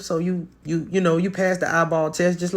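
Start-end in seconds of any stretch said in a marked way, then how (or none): none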